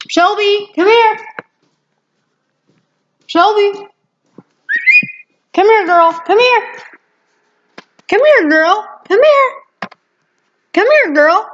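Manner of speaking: high, loud call to the dog. Real, not acted. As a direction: call your dog from across the yard. Calling the dog over.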